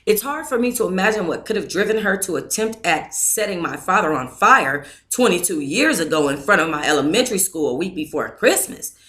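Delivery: ordinary delivery, nothing marked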